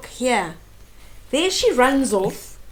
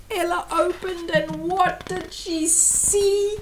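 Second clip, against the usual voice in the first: building suspense; 5 semitones higher than usual. A narrator's reading. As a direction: stretch it out before the big reveal. Builds suspense as door opens.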